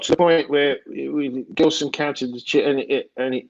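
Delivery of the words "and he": ordinary talk, nothing marked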